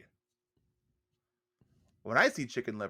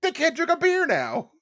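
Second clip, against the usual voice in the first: high loud comic protest; outraged shout; 7 dB louder than usual. Acted. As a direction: shout it like a tragedy. Mock outrage at liver dish.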